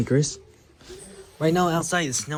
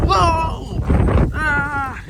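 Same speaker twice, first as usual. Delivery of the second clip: startled by the wind; 11 semitones higher than usual. Real, not acted. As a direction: yelp as the gust hits. Blasted by the wind.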